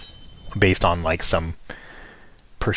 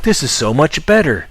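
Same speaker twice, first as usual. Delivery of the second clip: raised, louder delighted voice; thrilled quote; 8 semitones higher, 4 dB louder than usual. Retold or imitated. To herself, switching to the subtitled episodes, a raised, delighted gush. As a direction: gush like you just found something better. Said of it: "and I was like".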